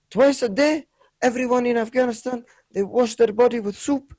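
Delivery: high-pitched imitation of Afghan accent; earnest, put-on Afghan accent. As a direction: explain it as the Afghan taxi driver, accent on. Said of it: "I need to do the accent".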